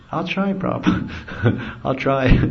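high, slow timid squeak; little squeaky voice. Retold or imitated. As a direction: squeak it out like a shy disciple. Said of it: "Little squeaky voice".

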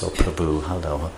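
low, hushed, sweet-toned greeting; soft, sweet tones. Acted. City lady greeting someone in sweet tones, acted soft and low. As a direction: imitate someone speaking softly and sweetly. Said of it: "They don't speak softly in sweet tones".

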